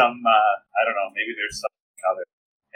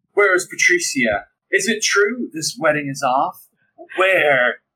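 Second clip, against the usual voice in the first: dramatic entrance; 5 semitones higher, much slower than usual. Acted. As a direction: make a melodramatic scene. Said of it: "be dramatic".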